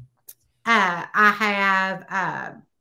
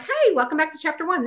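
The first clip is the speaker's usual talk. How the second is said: higher, quicker mock host greeting; video intro greeting; 7 semitones higher, faster than usual. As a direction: open like a cheery video host, briskly. Acts out her video intro.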